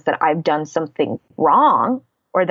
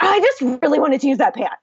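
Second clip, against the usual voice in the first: high, fast, sheepish whine; retold petulant excuse, rushed and high; mock petulant whine; 8 semitones higher, much faster than usual. Wife sheepishly explains the pan to her husband, high and rushed. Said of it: "I'm like".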